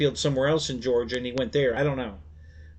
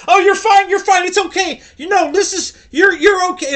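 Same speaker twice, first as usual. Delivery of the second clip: imitating flustered nurse; 10 dB louder than usual. Nurse calming a startled patient.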